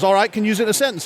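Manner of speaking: Australian radio host. Acted. Host asking caller for a sentence, quick Australian accent. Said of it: "this Australian radio station's holding a contest".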